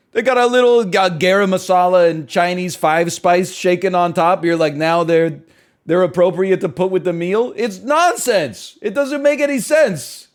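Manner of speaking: loud, high-pitched incredulous rant; exasperated rant. Real, not acted. Streamer ranting at chat about masala fries, loud and indignant. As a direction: hammer home how wrong it is.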